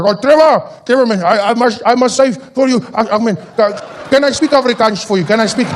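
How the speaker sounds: Afrikaans fan. Acted. Starstruck fan asking to speak Afrikaans, halting Afrikaans accent. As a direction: stumble through English in an Afrikaans accent. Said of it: "he's like".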